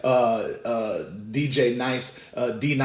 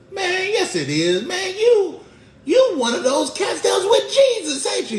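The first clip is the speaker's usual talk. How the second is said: very high, loud comic voice; insistent accuser; 8 dB louder than usual. Acted. Onlooker contradicting Peter about Jesus, high insistent squawk. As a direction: pester him with a high accusing holler.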